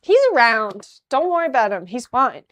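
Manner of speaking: loud, emphatic brush-off; pushed, too-loud reassurance; insistent cover-up. Pretend. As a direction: insist loudly that all is well. Covering for the missing scout.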